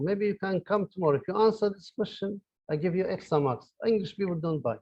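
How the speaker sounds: usual speaking voice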